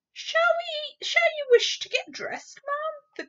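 a flustered maid eager to help; fussy maid. Acted. The maid's voice in story reading.

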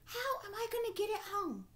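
put-on a delighted old woman puzzling over a heavy pot; excited old woman. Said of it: "And she was so excited".

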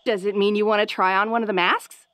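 ordinary delivery

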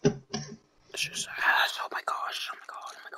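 soft voiceless whispering; anxious whisper. Real, not acted. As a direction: whisper oh-my-God under your breath. Tense muttering during final moments.

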